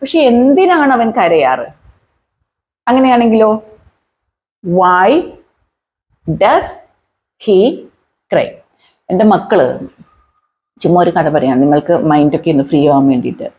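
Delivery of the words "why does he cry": isolated words with long gaps. Shown as a demonstration. Teacher adding why to the question, word by word. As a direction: dictate the question slowly, pausing after every word.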